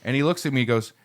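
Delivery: usual speaking voice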